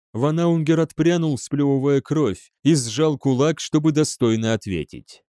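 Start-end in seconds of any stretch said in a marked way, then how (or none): none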